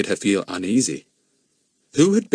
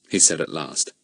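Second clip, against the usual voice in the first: ordinary delivery, nothing marked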